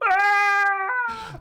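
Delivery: sudden high-pitched yelp; startled yelp, very high. Retold or imitated. Reacting to the jump.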